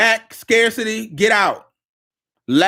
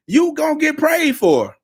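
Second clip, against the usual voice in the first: excited exclaim; 8 semitones higher than usual. Real, not acted. Promising clients prayer.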